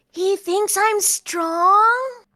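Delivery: loud, high, very drawn-out amazement; awed excitement. Acted. Totoi confiding in Serafina, loud amazed childlike voice. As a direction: stretch it out in thrilled disbelief. And then whispers.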